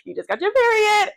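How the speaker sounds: high, gushing mom voice; squealing excited mom. Retold or imitated. Girl retelling how her mom squealed over her first period. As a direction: gush like a thrilled mother. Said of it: "She's like".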